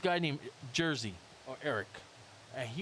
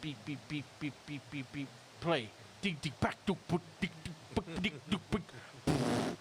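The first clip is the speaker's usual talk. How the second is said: beep boop machine noises; 4 dB quieter than usual. Retold or imitated. Imitating a drum machine beat.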